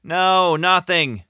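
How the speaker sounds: high answering call; shouting back. Acted. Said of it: "James shouted back".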